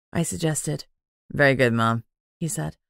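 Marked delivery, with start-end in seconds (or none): 1.3-2.0 courteous man, voice pitched lower; lower male voice. A narrator's reading. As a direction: speak as a polite younger man, low and even.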